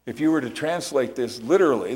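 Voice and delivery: ordinary delivery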